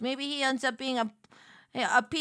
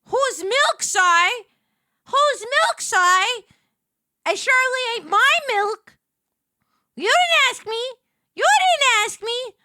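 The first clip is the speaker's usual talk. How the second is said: indignant scolding; 12 semitones higher, 11 dB louder than usual. Acted. Scolding a customer in character.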